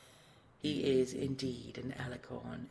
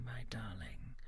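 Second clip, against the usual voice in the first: lower, soft whisper; whispered endearment; 4 semitones lower, 6 dB quieter than usual. Acted. Dragon to his love, a lower whisper. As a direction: breathe "my darling" tenderly. Tender aside to beloved.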